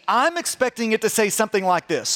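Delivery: ordinary talk, nothing marked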